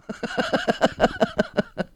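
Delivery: soft, very high-pitched tittering; high giggle. Retold or imitated. Doctor excited as Bill comes in, high giggle. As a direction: titter rapidly, high and light.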